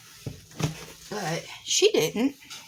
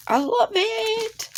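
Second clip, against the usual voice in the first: high gushing squeal; slower than usual. Real, not acted. Thanking friend for gnome painting.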